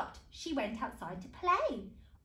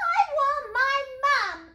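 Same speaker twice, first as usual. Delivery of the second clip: put-on very high, loud child's holler; piercingly high demand; hollering princess; 13 semitones higher, 12 dB louder than usual. A narrator's reading. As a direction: holler it like a stroppy little girl. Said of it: "hollered the little princess".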